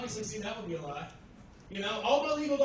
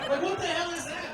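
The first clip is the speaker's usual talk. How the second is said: high-pitched, laughing disbelief; comic exasperation; 7 semitones higher, 4 dB louder than usual. Acted. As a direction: protest as if it's utter nonsense. Exasperated rant at fiancee's logic.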